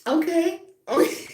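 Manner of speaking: squeaky high okay okay; mock surprised okay. Host replaying her reaction to the joke, high and loud. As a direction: play your own stunned reaction. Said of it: "And I was like".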